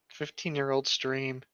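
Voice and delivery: ordinary talk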